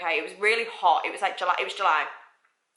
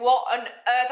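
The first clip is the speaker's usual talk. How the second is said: higher, louder astonished exclamation; retold screaming disbelief; 5 semitones higher, 5 dB louder, slower than usual. Speaker reacts to the boss's offer, louder retold shock. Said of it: "I was screaming".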